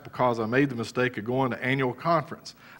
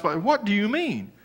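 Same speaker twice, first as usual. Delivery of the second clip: disbelief; 10 semitones higher, slower than usual. Retold or imitated. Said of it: "I just could not believe".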